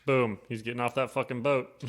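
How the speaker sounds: usual speaking voice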